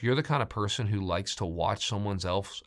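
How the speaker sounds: ordinary delivery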